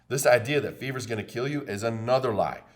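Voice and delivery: usual speaking voice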